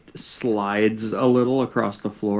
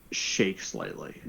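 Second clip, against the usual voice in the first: normal talking voice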